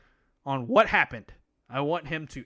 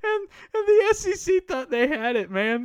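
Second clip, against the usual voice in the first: much higher, bright mock enthusiasm; gushing, much higher mock hype; mock excited; 10 semitones higher, 4 dB louder than usual. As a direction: squeal it like a proud kid. Mocking SEC's excitement.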